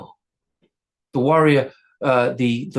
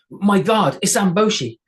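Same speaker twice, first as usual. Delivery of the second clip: higher, a little louder call; proudly naming her guard; girl; 6 semitones higher, 4 dB louder than usual. A narrator's reading. Girl warning the troll about her guard, raised voice. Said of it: "girl names her guard".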